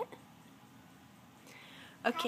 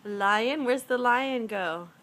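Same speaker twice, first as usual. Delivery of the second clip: put-on reading to a toddler; 5 dB louder, slower than usual. Parent talking to toddler at puzzle.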